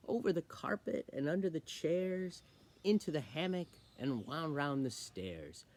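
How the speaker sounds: bouncy rhyme, lifted then dropping to hushed dismay; poem reading. Acted. Reader describing a spaghetti-covered party, animated verse recital. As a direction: recite with a swinging beat and a punchy ending. Reads a fun poem aloud.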